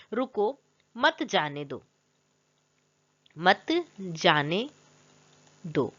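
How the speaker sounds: slow, spaced-out example sentence; drawn-out reading. Shown as a demonstration. Teacher reads out the example sentence, slow and drawn out. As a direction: say it word by word with long gaps. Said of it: "किसी को ऐसे कहते हैं रुको".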